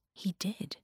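soft whispered admission. A narrator's reading. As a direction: let it fade to a whisper. Quiet admission.

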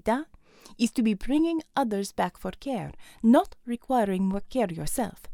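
lightly voiced Russian woman, brisk orders; a hint of a Russian commander's accent. Russian medic ordering Ramona to stay safe, light Russian accent.

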